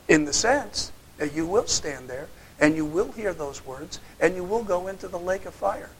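ordinary talk, nothing marked

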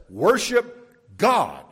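booming "Worship God." from the pulpit; emphatic command. Acted. Preacher voices angel's command.

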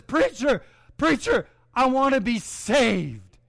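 a man asking the preacher a second time to save him; eager plea. Retold or imitated. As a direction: call out to the preacher, hopeful. Jim comes forward second time.

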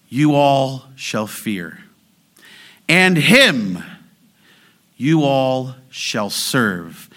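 very slow, measured verse in two halves; weighty, spaced-out recitation; solemn scripture reading. Acted. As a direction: raise each opening phrase, then drop for the response. Reads verse in poetic outline.

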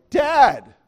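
loud, sharp shout of Dad! to break through; kid yelling Dad. Retold or imitated. Kid in the back seat shouting to get dad's attention, loud shout. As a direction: yell to snap a zoned-out dad back. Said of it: "one of the kids is like".

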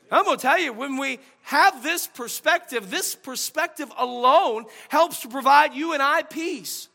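loud, high preaching voice; fervent sermon delivery. Real, not acted. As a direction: preach it out to the church. Preaching at full force.

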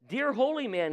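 crying out. Acted. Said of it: "he cried".

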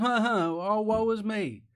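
high, hammed-up sighing woe; mock lament. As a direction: parody a showy self-pity. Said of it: "not just".

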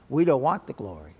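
usual speaking voice

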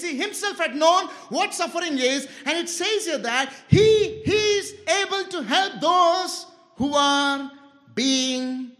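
loud, emphatic sermon voice; impassioned preaching. Real, not acted. Preacher contrasting Christ with customer service executives, impassioned. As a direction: drive each phrase home to the congregation.

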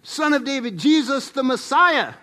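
high, loud cry to Jesus. Acted. Blind Bartimaeus calling to Jesus, high loud cry. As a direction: call out loudly, each name punched. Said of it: "blind Bartimaeus saying".

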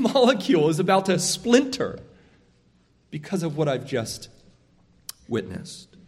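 normal talking voice

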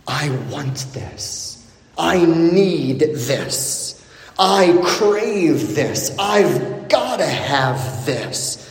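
slow, low inner craving; a hint of someone pleading to himself. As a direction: let each want drag out, slow and hungry. Voicing a listener's inner craving.

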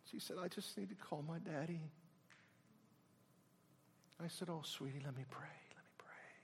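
soft whisper. Retold or imitated. Preacher whispers comfort to the grieving widow. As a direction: whisper comfort to someone in shock.

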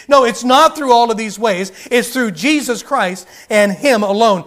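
loud, insistent sermon; fiery preaching; preaching at full force. Acted. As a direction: hammer each not home.

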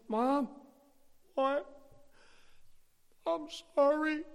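put-on high, loud blubbering kid; mock tearful apology. Boy to his crying mom, mock tearful apology. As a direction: wail it haltingly, imitating a sorry child. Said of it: "I stand there and she's crying".

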